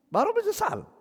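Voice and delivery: high, squeaky mocking jab; mock the late regret in a shrill falsetto; a squeaky sneer at regret that came too late; mocking squeal. Preacher mocks the late regret.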